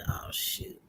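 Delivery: a dismayed whisper; whispered oh shoot. Retold or imitated. Woman caught by the coach, whispering in dismay. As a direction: breathe it out caught red-handed.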